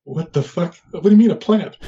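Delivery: baffled, what are you talking about; incredulous outburst. Retold or imitated. Man questioning a squid about getting a plant, incredulous.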